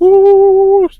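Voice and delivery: piercing high-pitched alien whoop; high alien woo. Pretend. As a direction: let out a squealing alien call. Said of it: "That's my alien sound".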